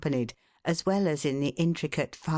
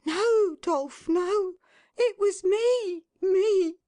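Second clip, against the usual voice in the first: much higher and louder, slow, with a crying catch; a wife insisting through tears it was her fault; 5 dB louder, slower than usual. A narrator's reading. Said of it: "cried Missus Tetterby".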